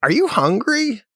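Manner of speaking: high, sweet mock question; mock wife. Imitating his wife asking if he's hungry, high mock voice. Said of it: "I got this from your wife".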